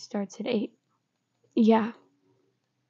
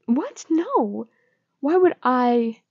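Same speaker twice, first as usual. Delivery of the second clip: rising, louder, protesting too much; defensive denial; 4 semitones higher, 7 dB louder, slower than usual. Acted. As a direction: deny it hotly, caught out. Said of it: "You sound like that".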